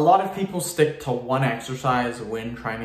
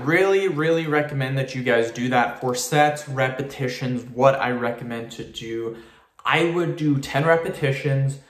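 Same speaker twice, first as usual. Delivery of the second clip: normal talking voice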